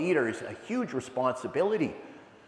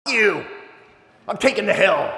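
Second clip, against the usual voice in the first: loud, higher defiant shout; defiant yell; 6 semitones higher, 7 dB louder than usual. Retold or imitated. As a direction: bark it back at the generals like a reckless sergeant. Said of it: "And then Tom Berenger goes".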